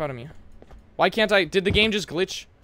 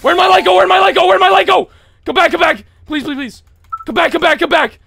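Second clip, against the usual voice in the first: high, loud, frantic repetition; real panic; 11 semitones higher, 9 dB louder than usual. Real, not acted. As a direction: shriek the same plea over and over. Light lost in game panic.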